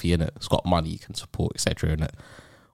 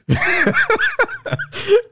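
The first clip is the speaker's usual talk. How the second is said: loud high laugh; 4 dB louder than usual. Real, not acted. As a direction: burst of high-pitched laughter. Laughing at the tuna joke.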